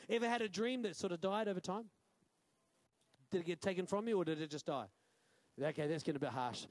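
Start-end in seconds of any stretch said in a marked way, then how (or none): none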